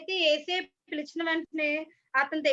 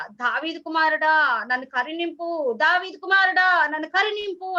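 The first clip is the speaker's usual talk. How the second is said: storyteller's raised calling voice, growing stronger; retold loud cry; 5 dB louder than usual. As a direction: imitate a blind beggar calling out for mercy. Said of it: "గట్టిగా అరుస్తారనమాట".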